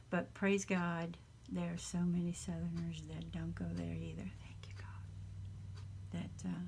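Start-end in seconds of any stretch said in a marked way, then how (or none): 4.4-6.2 soft, slow whispered prayer; whispered thanks. Real, not acted. A grateful whisper to God about kind Southerners, slow and hushed.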